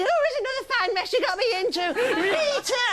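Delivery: a squeaky bad Hardy; Les Dennis as Oliver Hardy. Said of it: "Les Dennis doing Oliver Hardy".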